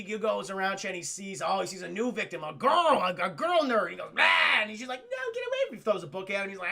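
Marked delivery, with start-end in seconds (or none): 4.1-4.6 mock vampire snarl. Morbius pounces in retelling. 5.0-5.8 very high squeal, pushing away; a high mock panic; frightened girl. Girl nerd voiced in retelling.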